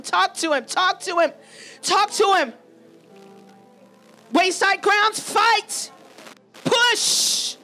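ordinary talk, nothing marked

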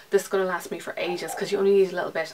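usual speaking voice

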